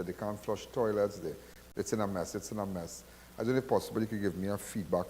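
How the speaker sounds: soft, courteous message to a boss; polite and respectful. Shown as a demonstration. Host dictating a polite note to the water CEO, soft and courteous. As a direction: speak gently and respectfully, like a formal voice note. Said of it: "speaking like that".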